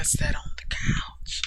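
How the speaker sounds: soft unvoiced narration; whispering. A narrator's reading. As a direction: whisper the line.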